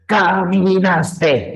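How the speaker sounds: "Caminaste" chopped into beats like a rock drummer; slow, rhythmic ca-mi-nas-te on each beat; syllables on the beat. Shown as a demonstration. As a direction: keep time through "Caminaste" as if drumming. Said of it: "como si fueras rockero".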